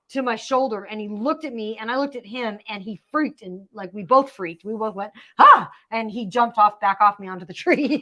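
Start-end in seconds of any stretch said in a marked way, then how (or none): 5.4-5.7 sudden loud yelp of fright; startled scream. Retold or imitated. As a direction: yelp like you just got scared. Retelling she and chipmunk freaked.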